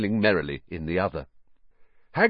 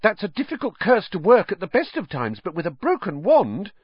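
put-on higher, earnest girl's voice; a higher, earnest schoolgirl; knowing Hermione; 10 semitones higher than usual. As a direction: explain it like a worried know-all. Hermione explaining the curse.

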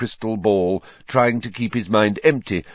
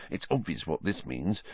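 much softer hushed aside; whispered; 2 semitones lower, 10 dB quieter than usual. Acted. Ron joking to friends, hushed voice. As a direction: drop right down, hushed joke. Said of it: "Ron whispered".

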